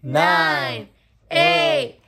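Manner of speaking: loud, high counting down; new year countdown crowd. Real, not acted. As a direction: call out the countdown.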